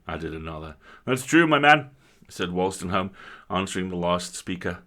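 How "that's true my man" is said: wry country squire, ringing out; host breaks bad news to his guest wryly; rueful host with news. Squire tells his guest the mine flooded, louder and wry.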